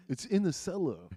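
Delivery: high, loud mock upper-crust accent; hammed-up high-society accent; Thurston Howell. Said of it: "we don't talk like that here".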